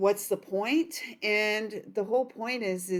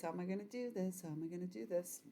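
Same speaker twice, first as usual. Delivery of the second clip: much softer, fast, under the breath; muttering to herself; 15 dB quieter, much faster than usual. Real, not acted. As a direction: mumble it to yourself as you think. Musing while reading cards.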